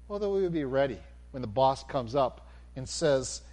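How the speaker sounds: ordinary talk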